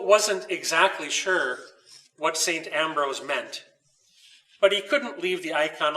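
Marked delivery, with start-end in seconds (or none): none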